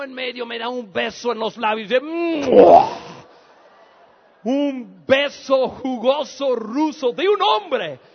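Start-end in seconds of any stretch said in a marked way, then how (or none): 2.0-3.1 long wind-up into a loud kiss noise; exaggerated, explosive mwah; huge smacking kiss. Imitates the big kiss on lips. 4.4-8.0 loud, slow, word-by-word outrage; comic loud horror, drawn out; indignant disbelief. Acted. Mock outrage at the kiss.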